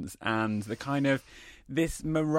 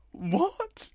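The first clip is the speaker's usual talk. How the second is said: shocked; 14 semitones higher than usual. Retold or imitated. Shocked at the shrunk flat.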